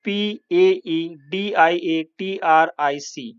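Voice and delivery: letter by letter for the class; British spelling, spelled slowly. Shown as a demonstration. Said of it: "paediatric".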